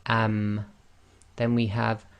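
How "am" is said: "am" with the dry part of the lips pressed shut; Arabic am with sukun. Shown as a demonstration. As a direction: close the lips fully on "am" and hum. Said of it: "dry portion of your lips touching".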